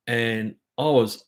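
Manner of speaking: ordinary delivery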